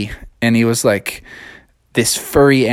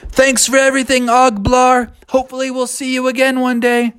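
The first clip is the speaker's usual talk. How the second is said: cheerful Bubby; 11 semitones higher than usual. Acted. Bubby waves goodbye.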